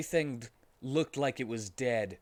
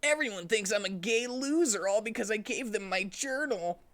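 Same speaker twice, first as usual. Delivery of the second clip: 1 whiny Sonic; 10 semitones higher than usual. Acted. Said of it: "Sonic cried a little".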